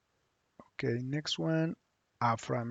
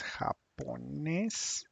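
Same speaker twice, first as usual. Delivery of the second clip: "japonés" drawn out in separate syllables, rising at the end; slow, syllable by syllable; 4 dB quieter, much slower than usual. Shown as a demonstration. Spanish teacher repeats the new word "japonés" for students, slowly and syllable by syllable. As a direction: stretch "japonés" out slowly for learners.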